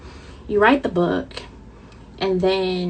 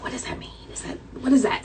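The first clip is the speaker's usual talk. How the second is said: mock puzzled whisper; 5 semitones higher, 3 dB quieter, faster than usual. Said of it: "And people are like".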